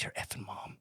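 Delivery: a hushed, unvoiced whispered curse; grandma's whisper. Grandma secretly cursing his mom in a whisper. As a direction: whisper it like a forbidden word. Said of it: "my grandma doesn't cuss".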